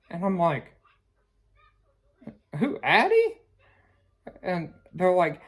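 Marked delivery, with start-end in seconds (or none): 2.6-3.4 confused surprise. Retold or imitated. Confused reply to clerks.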